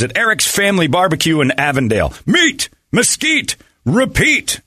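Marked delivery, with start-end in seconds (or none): 2.3-4.6 high, very slow slogan read. Acted. Host reading the barbecue ad slogan, slow and high. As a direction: sell the slogan with a flourish.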